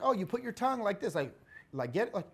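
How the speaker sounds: a hint of a patient mother showing how; mild mock mom coaching a kid. Mom teaching kid to whistle.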